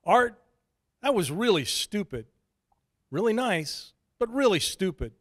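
Shane scolding. A narrator's reading.

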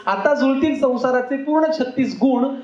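lilting wedding-verse recital; ukhana recitation. Acted. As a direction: recite the rhyme out to the audience, phrase by phrase.

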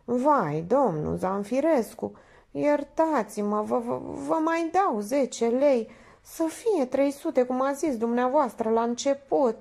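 high, slow, faltering plea; timid mumble. A narrator's reading. Minerva begging the painter's forgiveness, timidly faltering. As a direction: stammer an apology, cowed. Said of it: "îngână abia auzit".

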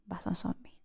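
hushed unvoiced whisper; sneaky whispered realization. Real, not acted. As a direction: whisper it while hiding. Scouting enemies quietly.

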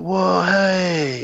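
lightly voiced stoned slacker; stoner voice. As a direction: drawl it like a stoner. Acting stoned Floyd.

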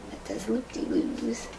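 plain reading voice

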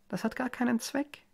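sing-song taunt, pitched well up; sweet taunt. A narrator's reading. Mary teasing her helpless husband, sing-song.

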